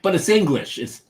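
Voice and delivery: put-on playful Italian accent on very nice; Italian-accented English. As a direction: mimic an Italian host saying very nice. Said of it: "that was his accent".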